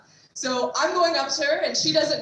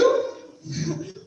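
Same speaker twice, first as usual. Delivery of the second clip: awkward laugh; 9 semitones higher than usual. Real, not acted.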